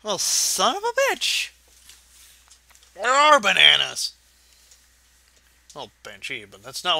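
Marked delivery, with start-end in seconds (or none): 0.0-4.1 sudden high burst of surprise; surprised outburst. Real, not acted. Alone at the screen, he spots bananas and yelps in surprise. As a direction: yelp at finding what he looked for. Finds bananas after searching.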